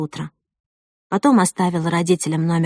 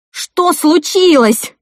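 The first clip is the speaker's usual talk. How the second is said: high, loud, slow cry that cracks; broken-voiced cry; 11 semitones higher, 8 dB louder, much slower than usual. Bride kneeling by her injured sister, breaking cry. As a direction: call out, voice breaking with fear. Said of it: "крикнула я прерывающимся голосом".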